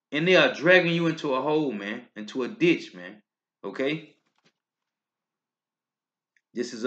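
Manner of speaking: usual speaking voice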